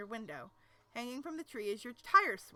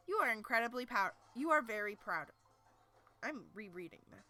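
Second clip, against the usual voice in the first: ordinary delivery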